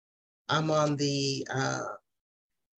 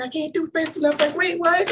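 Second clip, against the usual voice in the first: excited scramble; 13 semitones higher, 4 dB louder than usual. Retold or imitated. Rushing to win the prize.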